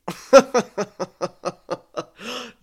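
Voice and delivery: high, loud burst of laughter. Real, not acted. Reader breaking off from the dramatic story, laughing.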